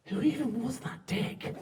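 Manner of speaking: mock-dismissive quote; baffled disdain. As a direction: imitate a friend's slow, baffled put-down. Retelling a friend's dismissive question.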